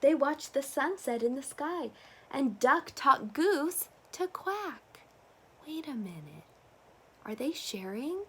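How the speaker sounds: usual speaking voice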